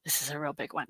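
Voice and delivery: conspiratorial whisper, hushed and secretive. Acted. Host teasing viewers about a big show, whispered. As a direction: let them in on a secret under your breath.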